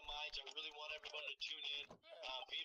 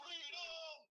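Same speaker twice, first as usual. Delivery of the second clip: shout. Pretend. Mock rallying cry, shouted.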